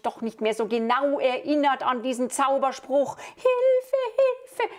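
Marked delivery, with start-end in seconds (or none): 3.2-4.7 princess screaming for help. Acted. Princess crying for help as the wizard turns her into a crocodile, shrieked high. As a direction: shriek for help as the spell hits.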